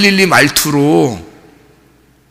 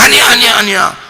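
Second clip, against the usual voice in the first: a higher, flustered denial; Peter's denial; 8 semitones higher than usual. Retold or imitated. Peter denying he is Galilean to the crowd, mildly flustered. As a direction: brush it off, a touch panicky. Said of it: "그러니까 베드로가".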